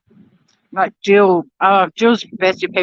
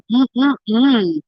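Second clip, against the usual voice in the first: yummy hum; 4 semitones higher than usual. Real, not acted. Eating toast, enjoying it.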